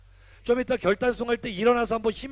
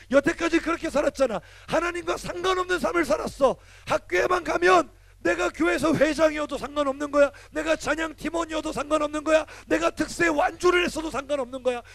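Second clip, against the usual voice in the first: higher and louder, urgent warning; fired-up preaching; 8 semitones higher, 5 dB louder than usual. Acted. Pastor warns teens about school peer pressure, fired-up. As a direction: preach it hard, build the urgency.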